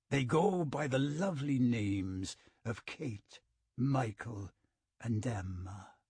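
smooth and chilling, in no hurry; cold lilting voice. A narrator's reading. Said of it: "his voice strangely cold and lilting".

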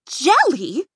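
"Jelly" with a rising, baffled question tune; puzzled echo. A narrator's reading. Twins echoing Dot in disbelief, rising "Jelly". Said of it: "echoed the fashion twins".

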